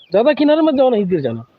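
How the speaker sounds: high, loud call of alarm; calling out a warning. Real, not acted. Calling to someone near the roof edge, high and loud. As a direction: shout to stop them at the edge.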